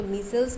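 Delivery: मीसेल्स with first syllable like "me"; variant pronunciation. Shown as a demonstration. Teacher showing students how some people say it, मीसेल्स pronounced "MEE-selz". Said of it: "बहुत लोग बहुत तरीके से".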